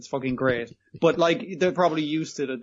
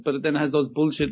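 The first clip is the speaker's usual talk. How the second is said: ordinary talk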